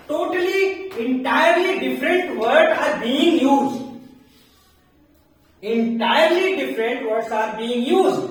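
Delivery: very slow, word by word, for the class; slow emphatic teaching. Shown as a demonstration. Teacher to students: slow, deliberate explanation. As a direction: spell it out slowly so students catch it.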